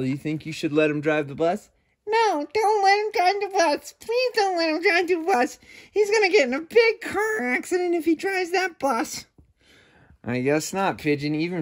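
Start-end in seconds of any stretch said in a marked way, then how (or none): 2.1-9.3 very high, shy little dog voice; high timid puppet, afraid of the shark; timid puppy puppet. Acted. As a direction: squeak it out like a nervous puppy. Furball answers the question.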